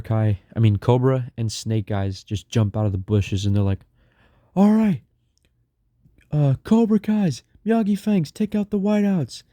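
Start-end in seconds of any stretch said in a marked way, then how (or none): 4.5-9.4 battle command shout. Retold or imitated. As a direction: yell the charge order like a war leader. Leader orders attack from bushes.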